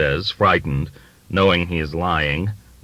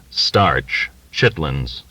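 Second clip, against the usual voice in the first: ordinary delivery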